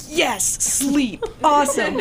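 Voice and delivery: mock relief at finally getting to sleep; gleeful fishermen. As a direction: imitate tired workers thrilled to quit. Night-shift fishermen imagined quitting.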